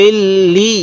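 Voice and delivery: "ইল্লি" with doubled l and a long drawn ee; one-alif stretch. Shown as a demonstration. Teacher showing learners the alif-length pull, drawn-out joining. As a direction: hold the double l in "ইল্লি", then stretch the vowel. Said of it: "কীভাবে শিখব?".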